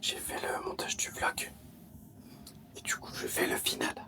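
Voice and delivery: whispering so others can sleep; hushed late-night whisper; quiet at night. Real, not acted. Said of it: "Tout le monde dort".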